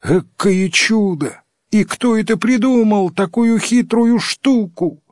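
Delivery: high-pitched amazed exclaiming; villagers calling out. A narrator's reading. Third village wonders who invented it, high amazed calling. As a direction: marvel aloud, pitched well up. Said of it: "кричали в третьей деревне".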